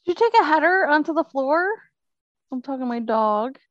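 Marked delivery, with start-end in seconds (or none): 0.0-1.7 calling out. Real, not acted. Shouting to the dog.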